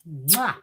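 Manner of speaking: grateful "mwah" kiss; big kiss smack. Real, not acted. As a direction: blow an audible kiss of thanks. Blows a thank-you kiss to Carrie.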